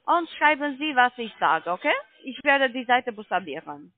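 ordinary talk

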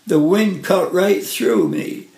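usual speaking voice

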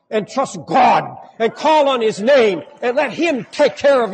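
loud, soaring preacher voice building to a climax; preaching at full force. Acted. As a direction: rise up and proclaim it to the congregation.

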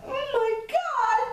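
very high, loud squeaky cub voice; Baby Bear. Acted. Baby bear finding his porridge eaten, high upset wail.

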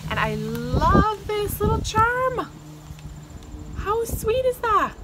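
high, loud, drawn-out gushing; squealing delight. Real, not acted. Showing a little charm from a friend, thrilled.

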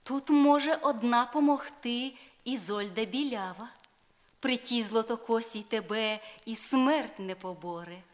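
lightly voiced sly fairy Urganda giving advice. A narrator's reading. Fairy Urganda tells Tristan only Isolde can help, slyly. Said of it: "сказала лукава".

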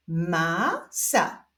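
stretched first syllable, pause, then second; drawn-out, divided syllables; syllable reading. Shown as a demonstration. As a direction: read it slowly as two separate syllables. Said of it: "doczytujemy sylabę różową".

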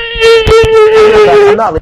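high, loud, very slow wail; drawn-out wail. Pretend. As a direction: stretch it into a wailing cry. Drawn-out wailing protest.

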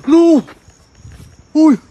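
loud, high frightened cry; scared shout at the strange voices; startled shout. Real, not acted. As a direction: yelp out in real fright. Startled by spirit box word.

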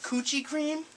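ordinary delivery

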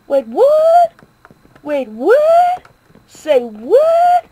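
high, loud disbelieving cry; can't believe the number, cry out; shocked. Real, not acted. Kid gamer shocked at his own kill count.

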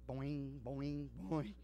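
quiet low boing of a diving board; soft imitated boing. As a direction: bounce it softly.

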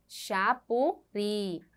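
"Chapuri" drawn out very slowly, syllable by syllable; sílaba por sílaba. Shown as a demonstration. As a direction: split "Chapuri" into slow syllables, landing on the final "ri". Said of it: "qual é a sílaba tônica". ("Chapuri" bem devagar, separando as sílabas até o "ri" tônico)

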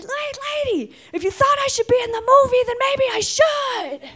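put-on high, sassy teenage voice; cocky teen. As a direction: sass the lady as a bratty kid. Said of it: "And I said".

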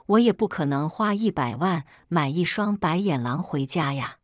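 plain reading voice

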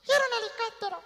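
put-on small boy's high pleading voice; childlike plea. Little boy begging his mom for a helicopter, high childlike plea. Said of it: "Yo le decía, Mami".

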